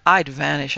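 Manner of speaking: firm emphatic "I'd vanish", "I'd" weighted; driving it home. A narrator's reading. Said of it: "I said, driving it home".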